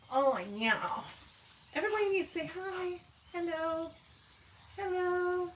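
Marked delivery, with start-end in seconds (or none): 1.8-5.5 high, cutesy voice for the dog; dog's voice. Acted. Owner voicing her dog for the chat, high and cute. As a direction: speak for the dog sweetly to viewers.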